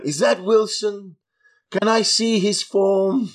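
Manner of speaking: put-on high, slow theatrical old actor; posh luvvie voice. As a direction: ham up a grand old thespian. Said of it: "He's going".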